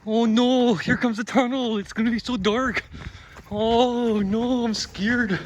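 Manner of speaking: high, hammed-up frightened squeal; mock scared. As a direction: play-act being spooked by the dark. Mock fear entering dark tunnel.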